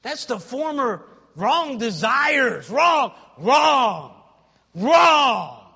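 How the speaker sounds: slow, loud, high hammering on "wrong"; shouted preaching. Real, not acted. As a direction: shout each "wrong" as its own blow.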